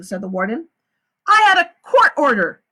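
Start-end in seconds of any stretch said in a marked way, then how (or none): 1.3-2.6 loud, high, firm retort; the lawyer. A narrator's reading. The lawyer retorts to the warden, loud and high. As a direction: slam down the order.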